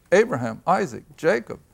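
ordinary delivery

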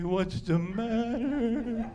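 slow, mock comforting woman's voice; sympathetic woman. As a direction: coo it like a caring friend. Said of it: "when women cry, they support".

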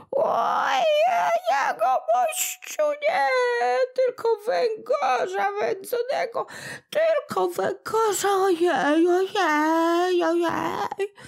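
very high, tearful whine of a tiny creature; a sore little imp wailing for pity; whimpering little goblin. Acted. Little kłobuk crying to old Smętek, very high and tearful.

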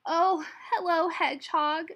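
high, loud cartoon otter greeting; perky otter. A narrator's reading. Otter greeting Hedgehog on the riverbank, perky. Otter's reply voiced in story.

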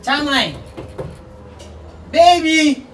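loud, high calls across the distance; calling out. Real, not acted. Calling out loudly to someone far away. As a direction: shout the name out to someone far off.

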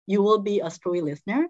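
ordinary talk

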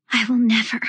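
gasping between breaths. A narrator's reading. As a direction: pant the words out. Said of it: "between broken bites of oxygen".

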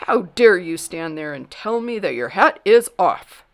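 loud, indignant scolding; loud royal outrage; angry king. A narrator's reading. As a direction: thunder it as an affronted king. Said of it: "shaking an angry finger".

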